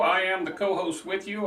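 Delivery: normal talking voice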